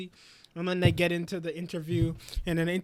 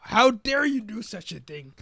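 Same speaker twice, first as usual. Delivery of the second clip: slower, higher, louder mock indignation; hammed-up righteous fury, each word weighted; Lloyd's outrage; 5 semitones higher, 5 dB louder than usual. Lloyd punching the interview table, slow indignant outburst. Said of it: "loses his mind and punches the table".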